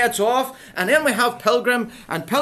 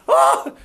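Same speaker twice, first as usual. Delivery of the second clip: loud acted "oh!" of someone going under; exaggerated groan of alarm; sinking cry; 8 dB louder than usual. Acts Pilgrim sinking in mud.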